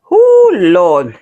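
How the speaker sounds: loud blissful oh Lord; loud exclamation. Real, not acted. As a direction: exclaim it, overwhelmed. Overcome by taste.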